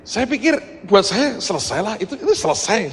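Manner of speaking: high, loud, cheerful; excited. Real, not acted. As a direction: sound delighted it's finished. Excited about being done.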